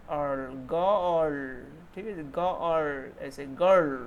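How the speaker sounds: गर्ल with the vowel drawn out; long vowel girl. Shown as a demonstration. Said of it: "आ sound होना चाहिए".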